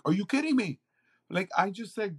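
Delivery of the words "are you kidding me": high, stunned question; amazed disbelief. Retold or imitated. Homeless young men receiving food, high stunned disbelief. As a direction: imitate the young men's disbelief. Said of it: "they're like".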